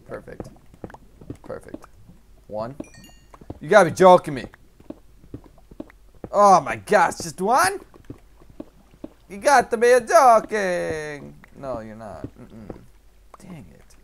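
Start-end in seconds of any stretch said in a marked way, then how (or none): 3.6-11.4 high, loud exasperated outcry; loud disbelief. Real, not acted. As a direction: protest in disbelief, stretching it at the end. Disbelief at finding only one diamond.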